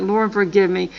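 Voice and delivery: ordinary talk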